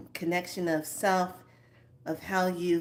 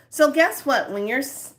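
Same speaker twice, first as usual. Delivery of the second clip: ordinary talk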